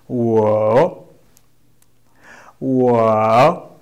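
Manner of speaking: "Wo" drawn out, low dip then rise; model pronunciation. Shown as a demonstration. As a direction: model a long dipping "Wo" for learners. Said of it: "try to pronounce the way I do".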